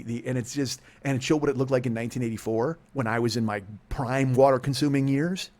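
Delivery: ordinary delivery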